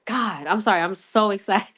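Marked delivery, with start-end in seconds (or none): none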